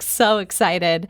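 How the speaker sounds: ordinary delivery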